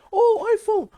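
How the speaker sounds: very high, hammy gasping fan; mock awe. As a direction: squeal over each new iPhone. Mock excited iPhone fans.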